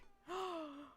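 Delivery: sharp excited gasp. Real, not acted. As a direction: gasp in delight. Excited reaction to message.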